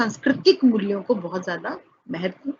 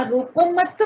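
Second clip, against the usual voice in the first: रोको मत run together at a higher pitch; रोको मत as one phrase; 6 semitones higher, slower than usual. Shown as a demonstration. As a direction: join मत tightly to रोको, then stop. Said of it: "उस व्यक्ति के शब्दों ने उसको कहा". (रोको मत बिना विराम, ऊँचे स्वर में)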